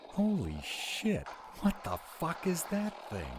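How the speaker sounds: high, loud startled outburst; shocked yell. Acted. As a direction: yell it in shock at something huge. Sudden sight of a monster.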